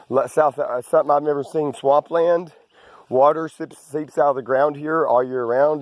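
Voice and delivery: normal talking voice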